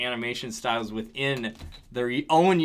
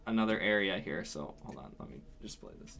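trailing off; 6 semitones lower, 11 dB quieter than usual. Real, not acted. Turns away to play clip.

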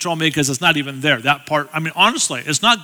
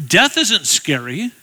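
ordinary talk, nothing marked

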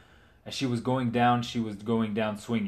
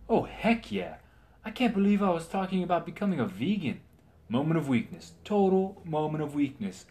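excited knight; 7 semitones higher than usual. Acted. Knight eagerly agrees.

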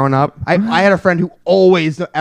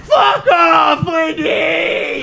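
put-on very high, stretched-out kid scream; mock tantrum; 13 semitones higher, much slower than usual. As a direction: shriek it like a furious child storming off. Acts an angry kid storming off.

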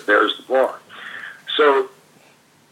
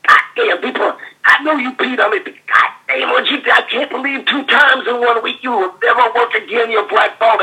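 much higher, raised mock tirade; imitating the furious Dream; 11 semitones higher than usual. The Dream yelling at the rookie who peed in his boot, high and furious. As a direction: rant in a squeaky outraged star voice. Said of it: "he's going".

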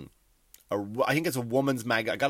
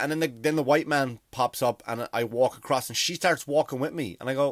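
usual speaking voice

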